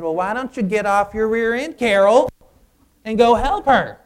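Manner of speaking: loud, high angry outburst; yelling at a lazy coworker to get up; lost my temper. Retold or imitated. Pastor retelling yelling at lazy coworker Carol, angry shout. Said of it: "I lost it on her".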